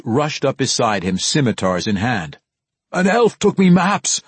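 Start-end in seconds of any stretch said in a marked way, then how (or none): 2.9-4.2 high, loud dwarf outcry; distraught, raised-voice complaint; crying out. Acted. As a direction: cry out the theft in alarm. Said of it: "Bruner cried at him".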